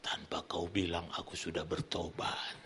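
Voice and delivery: low, hushed sickbed whisper; imitated whisper. Sick man confessing to the pastor, weak imitated whisper. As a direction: whisper it weakly like a patient. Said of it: "dia bisik".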